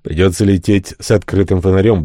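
ordinary delivery

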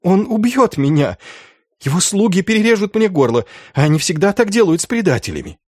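high-pitched, hurried plea; tearful panic. A narrator's reading. Schulz fears the sorcerer will kill him, tearful high panic. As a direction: rush it out in high, tearful fear. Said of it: "Шульц расплакался".

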